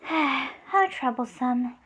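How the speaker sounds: slow, sighing mild exasperation; sigh, then drag the words out; weary sigh. Retold or imitated. Demon Lord finds Fatimus a nuisance, weary sigh.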